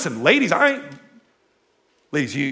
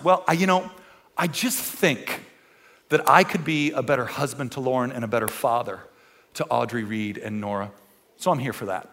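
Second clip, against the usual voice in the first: sheepish intruder; 8 semitones lower, 8 dB quieter than usual. Retold or imitated. Voicing intruder Stan in story.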